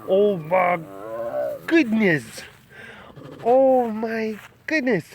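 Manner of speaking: high, slow cooing. Real, not acted. Cooing to baby animals.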